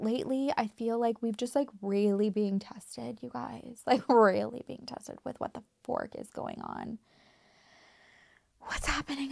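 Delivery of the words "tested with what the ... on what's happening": breathless whispered question; hushed real whisper. Real, not acted. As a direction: whisper it as if lost. Despairing about the world.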